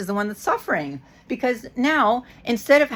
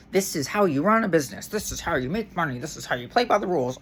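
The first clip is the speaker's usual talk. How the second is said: mock corporate voice; 3 semitones lower, 4 dB quieter, faster than usual. Mocking the company's corporate voice.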